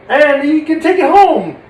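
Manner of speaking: loud, high happy climax; triumphant joy. Acted. Puppeteer ending puppy story, loud triumphant joy.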